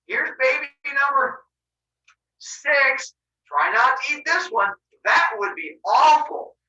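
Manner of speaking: high, loud, drawn-out put-on delivery; hammy fake innocence. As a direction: announce it with exaggerated, stagey innocence. Rhea hammily baits Cronus.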